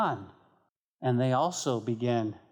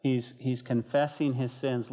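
normal talking voice

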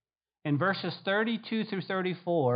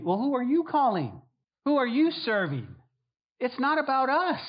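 pushing the question out, higher and louder; raised challenge; 8 semitones higher, 4 dB louder than usual. Real, not acted. Pastor challenging the congregation, raised and pressing. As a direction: challenge them with each question.

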